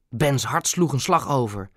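ordinary delivery, nothing marked

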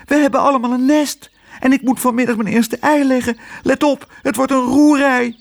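hoog piepend vogelstemmetje, klagend; klagend vogeltje. A narrator's reading. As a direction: speel een bezorgd lijstervrouwtje. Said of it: "klaagde het lijstervrouwtje Bessie". (klagende lijster met een hoog stemmetje)

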